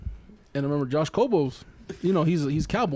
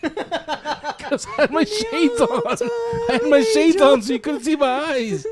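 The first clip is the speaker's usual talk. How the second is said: high, loud, squealing through laughter; words breaking up with laughter; laughing hard; 10 semitones higher, 5 dB louder, slower than usual. Real, not acted. Friend explaining his shades were on, laughing hard.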